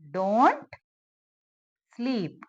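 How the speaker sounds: long pause between the two words. Shown as a demonstration. Teacher giving learners the translation, word by word. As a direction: hold off the second word.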